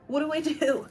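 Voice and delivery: high, flustered question; playful panic. Real, not acted. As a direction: ask in mock alarm. Panic over the bloom.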